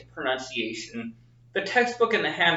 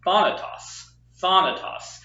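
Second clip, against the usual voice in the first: THA-natos, first syllable raised, then falling; stress on first syllable; 4 semitones higher than usual. Shown as a demonstration. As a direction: punch "Tha" high in "thanatos". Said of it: "the emphasis on the first syllable".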